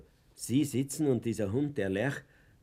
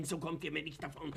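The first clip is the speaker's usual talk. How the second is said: ordinary talk, nothing marked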